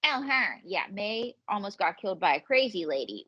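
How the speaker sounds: ordinary talk, nothing marked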